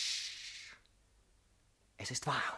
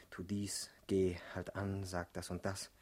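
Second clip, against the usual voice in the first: whisper; 5 semitones lower, 5 dB quieter, slower than usual. Acted. Barked orders recalled in whisper.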